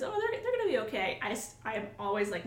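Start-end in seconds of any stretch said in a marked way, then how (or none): 0.0-1.2 high, quick, carefree; breezy reassurance. Retold or imitated. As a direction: toss off an easy all-will-be-fine. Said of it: "years of experience says".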